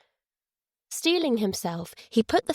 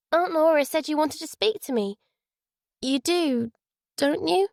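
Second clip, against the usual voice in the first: put-on high, unsure little girl; small voice seeking reassurance; doubtful child; 7 semitones higher than usual. A narrator's reading. Daughter asks if her father wants to talk, doubtful. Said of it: "the doubt in Naomi's voice".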